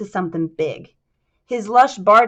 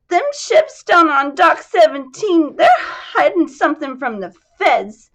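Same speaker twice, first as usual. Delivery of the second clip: tearful widow; 7 semitones higher, 7 dB louder than usual. Acted. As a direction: let the sobs break through the words. Widow cries about her husband.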